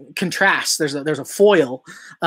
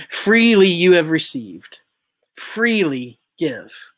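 slow solemn quote; slower than usual. Shown as a demonstration. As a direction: lean on Freely, then draw out freely give. Quoting scripture, then repeating it.

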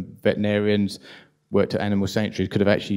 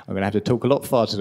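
rushed, hurried delivery; fast; much faster than usual. Real, not acted. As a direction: rattle it off quickly. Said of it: "I'm being given time warnings here".

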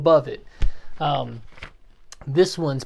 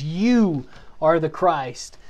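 stretched, emphatic You; much slower than usual. Retold or imitated. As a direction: stress on You, drawn out long. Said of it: "Peter kinda gets emphatic".

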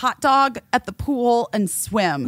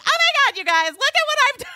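much higher, rushed, gushing; mock influencer squeal; 13 semitones higher, much faster than usual. Mother faking a cheerful story post, high and gushing. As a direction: gush like an Instagram story. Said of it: "I couldn't even be like".

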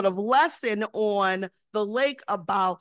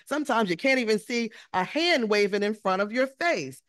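ordinary delivery

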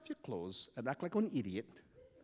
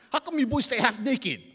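loud, raised incredulous question; acted astonished neighbour; booming disbelief; 10 semitones higher, 10 dB louder than usual. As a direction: demand it of two silly kids. Big neighbour confronts the boys.

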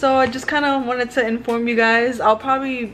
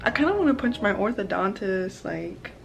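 quiet and anxious; 9 dB quieter than usual. Real, not acted. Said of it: "But I'm so anxious".

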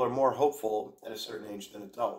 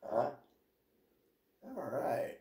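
ordinary talk

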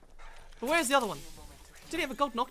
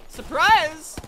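loud, high gleeful "surprise"; spring it on an unsuspecting foe; ambush shout; 6 semitones higher, 14 dB louder than usual. Real, not acted. Player jumps out at an enemy, gleeful shout.

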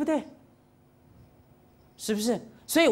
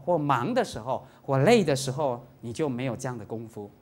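usual speaking voice

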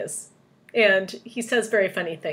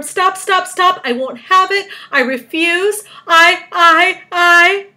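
high, loud, frantic protest; crying out; 11 semitones higher, 12 dB louder, slower than usual. A narrator's reading. Earthworm refusing to be bait, crying out. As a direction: refuse loudly, spluttering at the end. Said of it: "cried the earthworm".

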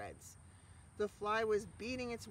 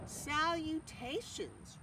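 slow, higher, drawn-out greeting; thin voice; 9 semitones higher, much slower than usual. A narrator's reading. Spider repeats her greeting in a thin voice. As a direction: stretch the fancy word slowly. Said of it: "repeated the voice".

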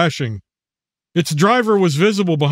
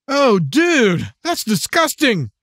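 put-on disgusted teen; 7 semitones higher, much slower than usual. Teen reacts to dog vomit.

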